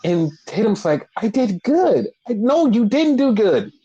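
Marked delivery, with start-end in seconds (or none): 1.2-2.1 imitating her in a raised pitch; high, childlike self-praise; high-pitched proud claim. Retold wrestler, proud of attack. 2.3-3.7 high-pitched imitated protest; mock-panicked scolding, pitched way up; freaking out. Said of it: "freaking out".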